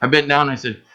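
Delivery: ordinary talk, nothing marked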